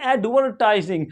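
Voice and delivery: एडवर्टाइजिंग with the R pronounced; American pronunciation. Shown as a demonstration. Teacher shows American English, R sounded. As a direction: curl a clear R before the T in एडवर्टाइजिंग. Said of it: "pronounce करेंगे".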